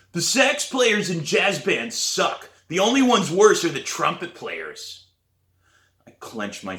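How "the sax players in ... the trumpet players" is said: loud, higher, drawn-out taunt; shouting. A narrator's reading. Danny to the lunch table, shouting a taunt. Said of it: "he starts shouting across the table".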